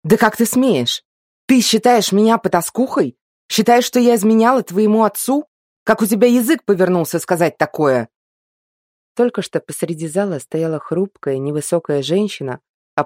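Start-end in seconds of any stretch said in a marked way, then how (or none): 0.0-8.1 furious. Acted. Enraged mother scolds son.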